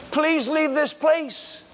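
high, slow, pleading warning; urgent plea. Retold or imitated. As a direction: beg him to flee, voice lifted high. Pharisees pleading Jesus to flee.

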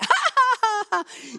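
loud, high, falling laugh; triumphant laugh. Shown as a demonstration. Preacher mocking Satan, loud triumphant laughing. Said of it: "och säga".

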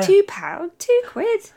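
high-pitched disbelief at the price; amazed. Real, not acted. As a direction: squeak it out in amazement.

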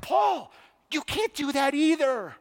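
high-pitched, scandalized rebuke; shocked scolding. Retold or imitated. Preacher as a bystander rebuking Paul, high shocked scolding. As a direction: scold him in acted disbelief.

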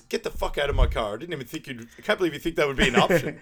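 dad throwing the guys out of his car, loud and fast; angry dad. Retold or imitated. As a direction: tear into them in disbelief. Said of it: "He goes".

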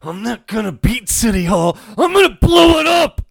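high, loud, building to a roar; Macho Man shout. Retold or imitated. Macho Man's promo line, building to a roaring shout. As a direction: belt it like a wild promo. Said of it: "My favorite line was".

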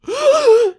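sharp, loud gasp of recognition; re-enacted gasp. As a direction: act out a big shocked intake of breath. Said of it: "I literally gasped".

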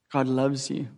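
usual speaking voice

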